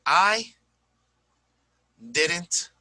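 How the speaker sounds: each word set apart with long pauses; I didn't eat your cake, spaced out like a drill; slow example sentence for students; slow word-by-word demo. Shown as a demonstration. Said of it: "teacher demo sentence".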